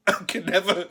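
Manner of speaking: can't get it out for laughing. Real, not acted. Telling a Trump story, he laughs through the words. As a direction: repeat it while a laugh breaks through. Repeats phrase, breaking into laughter.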